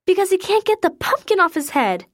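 put-on high, fluttery, fussing old lady; a flustered lady clucking over the poor animal; fussy lady caller. A narrator's reading. Caller to Keisha about the deer, high fussy lady voice.

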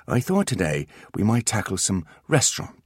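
a whispered line. Acted. Artemis tells the boys about the lesson in a whisper. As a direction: keep it to a whisper. Narrator reading unvoiced.

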